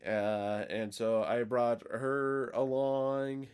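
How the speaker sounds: slow, drawn-out sheepish stalling; awkward excuse. Retold or imitated. Imitating diner explaining his substitute date to chef, awkward. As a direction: stretch the words out while imitating an awkward admission.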